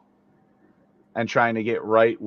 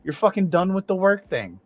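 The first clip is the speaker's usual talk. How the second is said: excited congratulations; 7 semitones higher than usual. Real, not acted. Congratulating friend's dad.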